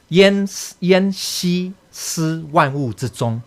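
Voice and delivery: slow, careful recitation of the Chinese; reading the Chinese line. Shown as a demonstration. Teacher reciting the text to students, slow and careful. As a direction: read the classical line out slowly, word by word. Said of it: "Now here comes the second".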